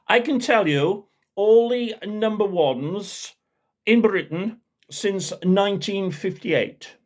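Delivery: high, slow posh show-off voice; pompous know-it-all bragging; posh loudmouth. Retold or imitated. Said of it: "He had a posh voice".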